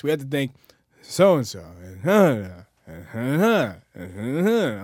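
droning flat announcer reading a list; monotone voice. Retold or imitated. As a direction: read it like a bored emcee. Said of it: "in a monotone voice".

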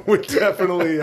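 laughing loud and high. Real, not acted. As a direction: laugh through the words. Laughter at joke.

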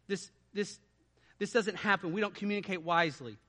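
usual speaking voice

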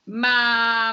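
ordinary talk

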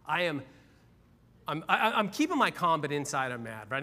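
ordinary talk, nothing marked

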